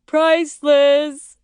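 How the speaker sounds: loud words through laughter; gasping, laughing delivery; laughing helplessly. A narrator's reading. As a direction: tease someone while cracking up. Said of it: "Danny can't stop laughing".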